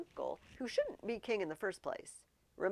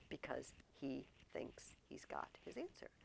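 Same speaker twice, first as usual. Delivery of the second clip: whisper; 4 semitones lower, 8 dB quieter than usual. A narrator's reading. Hushed aside to class.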